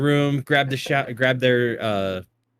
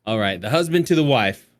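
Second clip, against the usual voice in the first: normal talking voice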